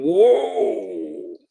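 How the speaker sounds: a sudden startled whoa from up on stilts. Retold or imitated. Looking down from stilts, a startled high whoa. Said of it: "looking down, going".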